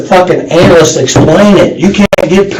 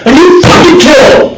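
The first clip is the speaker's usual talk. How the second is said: roaring, much higher and louder accusations; furious shout; 10 semitones higher, 3 dB louder than usual. Real, not acted. Detective shouting down a murder suspect, furious. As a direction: yell the accusation right in his face.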